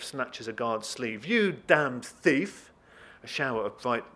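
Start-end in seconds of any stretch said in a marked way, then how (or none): 1.3-2.7 higher, loud and long-drawn; furious accusation. Acted. Deputy snatches thief's sleeve, furious drawn-out shout. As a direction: roar each word at the culprit.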